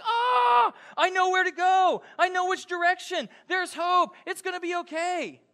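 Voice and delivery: high, loud burst of hope; relieved joy. Retold or imitated. Pastor voicing someone finding hope in darkness, high loud relief. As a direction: cry out with sudden relief. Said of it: "It's like".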